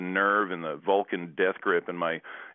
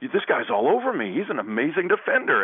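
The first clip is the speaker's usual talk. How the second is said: high, louder mock amazement; mock impressed opponent; 9 semitones higher, 5 dB louder than usual. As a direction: gush like an awed opponent. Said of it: "the guy was like".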